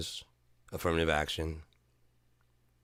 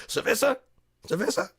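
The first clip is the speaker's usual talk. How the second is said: high, loud asking cerveza; mock coworkers; 6 dB louder than usual. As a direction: offer beer eagerly each time. Coworkers offering beer.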